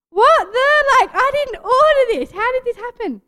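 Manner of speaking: high, loud shocked outburst; shocked disbelief. Retold or imitated. Unexpected straightener arrives at house.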